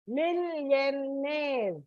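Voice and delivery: "Millionaire" very slowly, each syllable stretched. Shown as a demonstration. Teacher demonstrating the word ending to children, "Millionaire" in long slow syllables. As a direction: draw "Millionaire" right out, lingering on the final "aire". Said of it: "When you pronounce it is".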